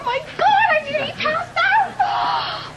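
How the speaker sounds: high, loud, laughing squeal; slow, overexcited fan shout; excited gushing. Acted. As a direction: gush like a starstruck fan. Gushing over a celebrity.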